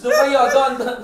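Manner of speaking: short, high mock hollers; imitated street calls. Mimicking people calling out on the road, loud mock hollers.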